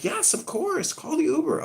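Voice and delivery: mock her eager sweetness; mock girly voice. Sarah buttering John up to get her ride, mock girly high voice.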